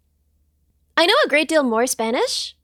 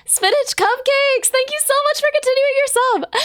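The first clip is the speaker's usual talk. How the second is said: much higher, delighted thank-you; excited thanks; 10 semitones higher than usual. Real, not acted. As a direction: gush over the loyal subscriber. Thanking a continuing sub.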